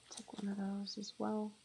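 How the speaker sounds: unvoiced whisper to herself; murmured aside while playing. Real, not acted. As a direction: breathe it out under her breath. Muttering to herself in game.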